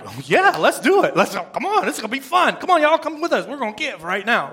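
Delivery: bouncy, acted-out eagerness with leaping pitch; rally friends to join the fun; hammed-up excited cheerleading; eager. Acts out eager, happy givers.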